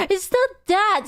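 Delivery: high laughter through the question; amused laughing question. Real, not acted. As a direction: giggle out the question. Laughs at chat.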